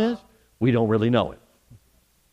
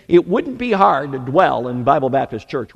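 ordinary talk

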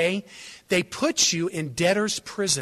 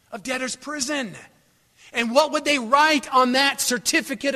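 raised, emphatic sermon delivery; impassioned preaching; 5 semitones higher, 5 dB louder than usual. Real, not acted. Preacher telling God's family about debt, impassioned. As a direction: build and drive the point home.

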